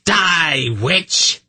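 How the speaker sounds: high, vicious cry of triumph; exultant shout. A narrator's reading. Kabat exults over the drowning witch, shouting. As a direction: shout it as a gloating curse. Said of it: "she shouted".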